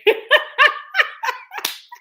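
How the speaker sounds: high, loud peal of laughter; bursting laugh. Real, not acted. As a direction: crack up helplessly mid-sentence. Breaks into laughter mid-praise.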